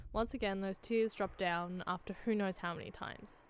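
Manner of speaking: ordinary talk, nothing marked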